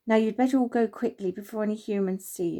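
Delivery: ordinary delivery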